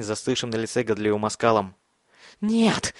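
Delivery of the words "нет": frantic scream. A narrator's reading. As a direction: scream it in terror. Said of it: "брызгая слюной, кричал Бен".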